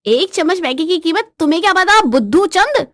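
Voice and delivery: heated, scornful comeback; scornful wife. Acted. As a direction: belittle your foolish husband. Wife insults husband in quarrel.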